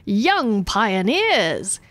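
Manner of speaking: very slow, drawn-out rallying call; pompous governor hailing the crowd; grand proclamation. Acted. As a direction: stretch YOUNG like a speech opener. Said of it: "he says".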